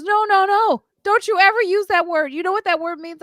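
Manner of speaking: high, loud mother's reprimand; scolding mom. Retold or imitated. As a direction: snap at the kid in alarm.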